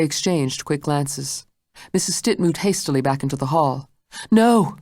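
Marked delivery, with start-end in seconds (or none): 4.3-4.8 sharp cry, higher and louder; cried out. A narrator's reading. Blanche cries out to stop the maid, higher and louder. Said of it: "Blanche cried".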